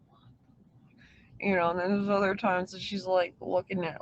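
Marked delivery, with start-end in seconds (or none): 0.1-1.4 slow breathless whisper of shock; whispered disbelief. Retold or imitated. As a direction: mouth it in stunned disbelief. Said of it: "I was like".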